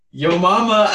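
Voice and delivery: gleeful punchline, cracking up; laughing taunt. Real, not acted. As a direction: blurt it out, breaking into laughter. Springs a joke insult, laughing.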